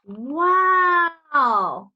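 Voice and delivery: gasp, then a loud wow; be truly amazed; gasp of wonder. Real, not acted. Reader gasping at the flying birds.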